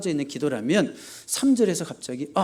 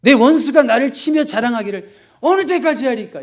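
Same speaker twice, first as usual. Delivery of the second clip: high, loud, indignant demand; angry cry to God; 8 semitones higher, 9 dB louder than usual. Acted. Pastor reading David's complaint against his enemy, loudly indignant. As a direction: pastor voicing the psalmist's protest against enemies, loud and angry. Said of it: "약간 화를 내는 거예요".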